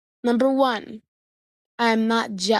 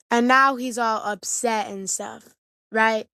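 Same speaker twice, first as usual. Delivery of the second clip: ordinary delivery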